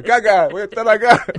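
posh mock reply. Apodo pijo fingido.

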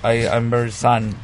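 slow Chilean broken English. Pretend. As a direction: grope for words in bad English. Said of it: "hablar en inglés inventado". (inglés inventado con acento chileno)

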